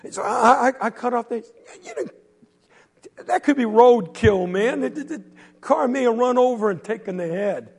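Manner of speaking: high, loud scoffing yell; loud disbelief. Acted. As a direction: yell it's just roadkill. Quentin yells skeptically.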